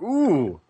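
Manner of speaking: high, drawn-out ooh of recoil; high-pitched mock-appalled noise; imitated wincing "ooh". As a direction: re-enact your wince at an ugly sight. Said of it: "I think I made a verbal".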